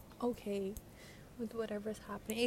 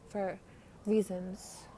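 ordinary talk

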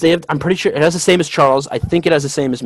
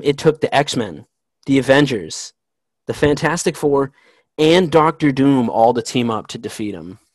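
usual speaking voice

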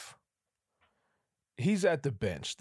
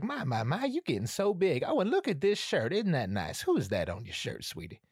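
grandma fussing; 5 semitones higher than usual. Retold or imitated. Grandma admiring the boy's shirt, higher and doting. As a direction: fuss over his shirt like a southern granny. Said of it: "She's like".

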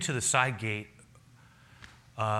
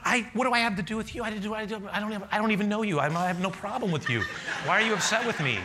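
higher, quicker flustered pleading; mock bewildered protest; 9 semitones higher, faster than usual. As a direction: act baffled and a bit hurt. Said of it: "I'm going".

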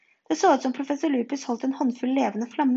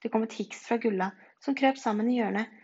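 plain reading voice

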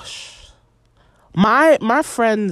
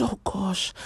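breathy whispered gasp, much softer; 2 semitones higher, 10 dB quieter than usual. Retold or imitated. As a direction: whisper it like she is overwhelmed. Overwhelmed by loud music.

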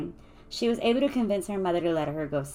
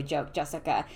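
ordinary delivery

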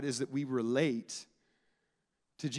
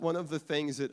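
normal talking voice

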